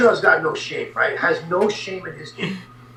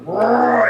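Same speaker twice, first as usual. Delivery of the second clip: loud, high mock roar; 7 semitones higher, 5 dB louder than usual. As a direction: roar like a charging animal. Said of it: "Blackman just went".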